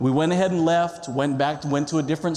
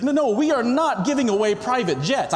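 exasperated retort; 6 semitones higher than usual. Pretend. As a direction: scoff at how ridiculous the charge is. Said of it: "I didn't say it like that".

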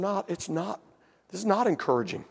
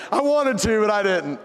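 laughing; 6 semitones higher, 8 dB louder than usual. Real, not acted.

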